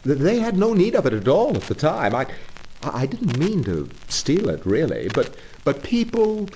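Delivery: high, stammering excuse; embarrassed Toad. Acted. Toad excusing his car theft to the engine driver, flustered. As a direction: fumble for justification.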